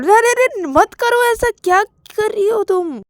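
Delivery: high, urgent objection; acted alarm in a high voice; alarmed protest. Acted alarm, stopping the phone call.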